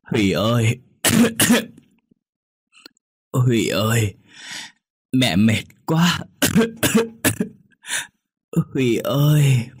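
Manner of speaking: weak calls broken by coughing; coughing and calling. A narrator's reading. Sick mother coughing as she calls her son. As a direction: call out between coughs, exhausted. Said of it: "Bà vừa ho vừa gọi con trai".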